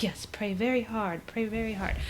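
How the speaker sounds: half-whispered, breath-heavy imitation of a mother; hushed urging. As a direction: whisper it as an acted plea. Retold mother urging child to pray.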